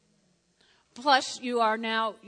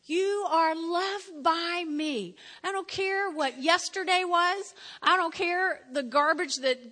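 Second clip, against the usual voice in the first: God's loving voice; 5 semitones higher, 5 dB louder, slower than usual. Acted. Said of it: "God's going".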